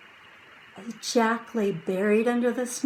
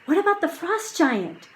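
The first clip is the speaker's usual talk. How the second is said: put-on young girl voice; 9 semitones higher than usual. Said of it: "Annie said".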